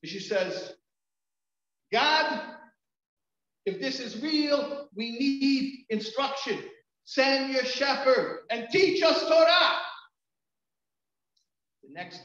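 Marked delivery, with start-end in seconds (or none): 1.9-9.8 loud, high, slow cry to God; slow, high shouted plea; retold screamed plea. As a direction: imitate her crying out a prayer, each phrase drawn out and building to a shout. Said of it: "screaming at the top of her lungs".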